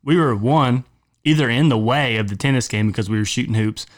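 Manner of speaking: ordinary talk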